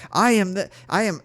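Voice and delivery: high, loud, insistent declaration; retold screaming. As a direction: proclaim it at a mock scream. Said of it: "I was just screaming".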